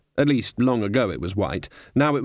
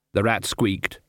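usual speaking voice

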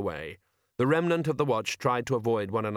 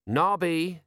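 sighing; 7 semitones higher than usual. Acted. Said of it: "sighed Colon".

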